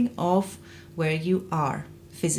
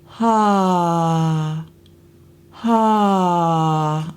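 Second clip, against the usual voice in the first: breathy onset; 2 semitones lower, 5 dB louder than usual. Shown as a demonstration. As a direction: let the air lead the ha. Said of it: "We want".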